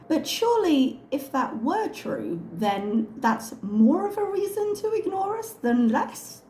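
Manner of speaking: high, incredulous rebuttal. Real, not acted. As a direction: argue it in disbelief.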